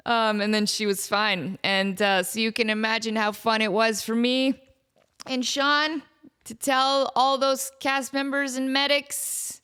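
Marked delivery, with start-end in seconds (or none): none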